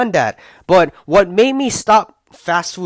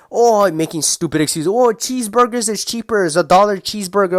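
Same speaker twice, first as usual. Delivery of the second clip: mock excuses; 6 semitones higher than usual. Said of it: "You guys may".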